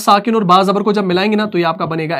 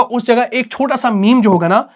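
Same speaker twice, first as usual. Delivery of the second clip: normal talking voice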